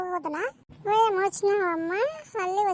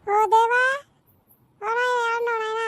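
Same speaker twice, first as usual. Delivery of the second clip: raised, louder exasperated call; 4 semitones higher, 7 dB louder than usual. Acted. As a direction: holler for someone to come. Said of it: "గట్టి కేకలేస్తున్నావు ఏందమ్మా?".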